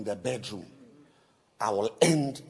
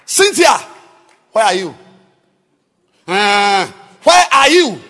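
calling out; 8 semitones higher, 21 dB louder than usual. Retold or imitated. Calling wife loudly.

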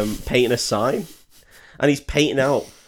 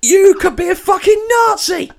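high, loud mock yell; hammed-up angry roar; mock furious shout; 11 dB louder than usual. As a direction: scream the accusation. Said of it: "He's like".

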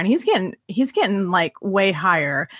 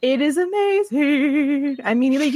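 gushing; 6 semitones higher than usual. Real, not acted. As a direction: swoon over it. Gushing about romance.

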